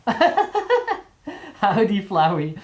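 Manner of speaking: high, hammed-up Southern howdy; goofy Southern accent. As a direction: put on a goofy Southern twang. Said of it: "You like my accent?".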